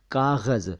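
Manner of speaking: normal talking voice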